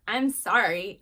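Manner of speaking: loud, grudging sorry; insincere apology. Shown as a demonstration. Child made to apologize, loud and insincere. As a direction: blurt an apology you don't mean. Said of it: "it's how you say it".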